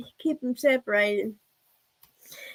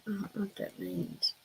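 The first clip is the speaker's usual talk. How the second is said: puzzled whisper; 4 semitones lower, 13 dB quieter than usual. Real, not acted. Puzzled aside after rereading comment.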